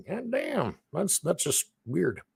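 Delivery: high, mock-baffled friend; mock friends reacting. Alaskan friends hearing the news, in a high mock-baffled voice. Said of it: "They're gonna be like".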